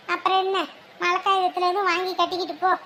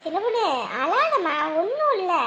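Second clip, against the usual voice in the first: normal talking voice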